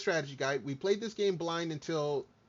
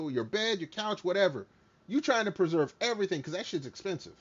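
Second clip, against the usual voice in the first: usual speaking voice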